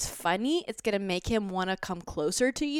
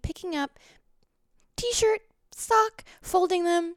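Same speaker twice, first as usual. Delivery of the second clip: much higher, cute playful maid voice; tidy up while doing a flirty high maid; sexy little maid; 10 semitones higher, 4 dB louder than usual. Pretend. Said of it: "like a sexy little maid".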